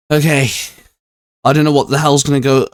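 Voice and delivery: usual speaking voice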